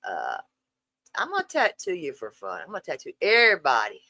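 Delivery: normal talking voice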